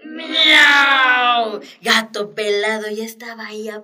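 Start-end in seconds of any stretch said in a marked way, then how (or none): 0.0-1.6 loud, wailing villain meow; evil cat meow. Shown as a demonstration. Teacher gives the second wicked meow, loud and wailing. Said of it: "Y el tercer maullido".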